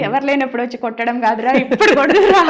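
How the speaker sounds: a mother's mass dialogue, half laughing; rough village mother. Acted. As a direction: scold the hero like a fierce village mom, breaking into laughter. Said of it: "బాగా viral అవుతుంది కదా".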